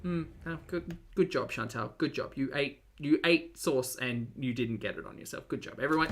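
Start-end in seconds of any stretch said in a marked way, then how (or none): none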